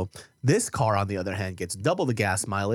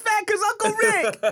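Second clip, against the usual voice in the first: much higher, slow mock little-kid voice; toddler voice; 5 dB louder, slower than usual. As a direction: squeak it like a small child blaming someone. Mimicking a toddler's logic.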